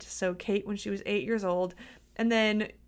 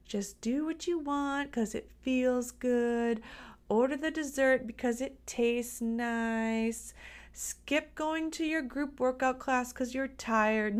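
slow, coaxing inner voice; little tiny voice; 3 semitones higher, slower than usual. Acted. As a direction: tempt the listener in a small, drawn-out voice. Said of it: "that little tiny voice in your head saying".

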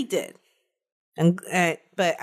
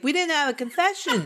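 higher-pitched mock excuse; mocking, pitched-up quote; imitating police excuse; 7 semitones higher than usual. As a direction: imitate detectives making a lame excuse. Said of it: "They're like".

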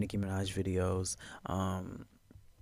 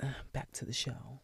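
hushed aside; whispering; 3 semitones higher, 8 dB quieter than usual. Real, not acted. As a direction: whisper it softly.

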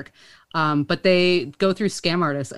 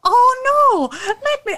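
high, loud mock-concerned psychic; hammed-up falsetto alarm; Miss Cleo; 5 dB louder, slower than usual. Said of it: "I'm gonna be Miss Cleo".

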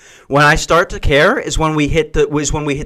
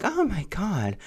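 put-on swooning girl; 3 semitones higher, 11 dB quieter, slower than usual. Girl swooning over guy.

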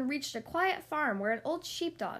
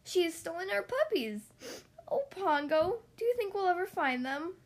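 tearful plea; 6 semitones higher than usual. A narrator's reading.

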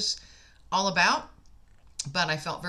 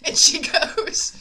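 laughing through the words, higher and louder; 10 semitones higher, 8 dB louder, slower than usual. Real, not acted. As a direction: break into a real laugh mid-line. Laughing while retelling the story.

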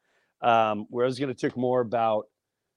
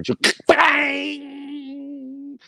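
high bang, pitched way up; mock punch. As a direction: sell the impact. Imagining the punch.

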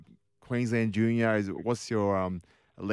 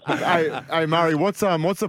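laughing; 7 semitones higher, 5 dB louder than usual. Real, not acted. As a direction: still laughing, raised voice. Host recovering from laughter.

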